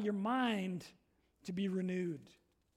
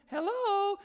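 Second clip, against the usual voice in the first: high, sing-out phone hello; put-on phone greeting; 7 semitones higher, 4 dB louder than usual. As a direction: imitate a sudden switch to a friendly phone voice. Angry sitter suddenly answers phone.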